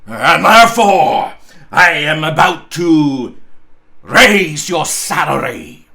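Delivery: booming reveal. Acted. Scrooge booming the raise to his trembling clerk, slow and loud. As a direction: boom out the good news slowly.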